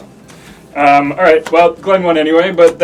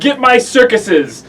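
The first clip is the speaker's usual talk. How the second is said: shouting orders, higher and louder; yelling; 9 semitones higher, 7 dB louder, much slower than usual. Acted. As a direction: call out to brother across the yard. Yelling to brother in story.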